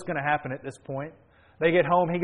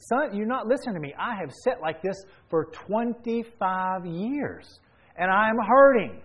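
indignant scolding; 5 semitones higher, 4 dB louder than usual. Retold or imitated. Grandma refusing to stand for the man, indignant imitation. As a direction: imitate a fed-up grandma, building to a loud finish.

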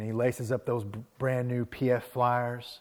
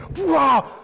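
loud, high burst of imitated speed; explosive mouth sound for a kid bolting; zooming-off noise; 13 dB louder than usual. Son races off in new shoes, explosive noise.

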